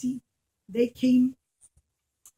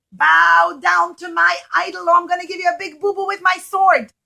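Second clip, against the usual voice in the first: loud, higher threatening king; menacing king; 6 semitones higher, 9 dB louder than usual. Acted. King threatening the oldest son, loud and menacing. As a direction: boom out the king's threat.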